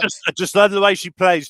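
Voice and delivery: a hint of Warren Barton's English accent. Host imitating Warren Barton's English accent to co-host. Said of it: "Oh, that's very nice accent".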